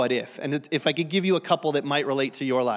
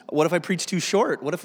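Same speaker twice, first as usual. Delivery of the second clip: ordinary talk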